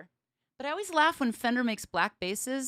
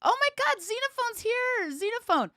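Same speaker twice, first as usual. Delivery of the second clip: much higher, giddy mock squeal; high, thrilled squeal over a new bass; squealing excitement; 11 semitones higher, 3 dB louder than usual. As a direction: gush like a thrilled fan getting a gift. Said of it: "I was like".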